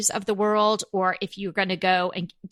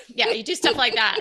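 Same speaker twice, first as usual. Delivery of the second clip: usual speaking voice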